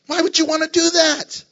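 normal talking voice

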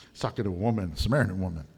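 low grumbling disciple, half laughing; scandalized grumble. Retold or imitated. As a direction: grumble about Jesus with mock outrage. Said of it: "correct and rebuke Jesus".

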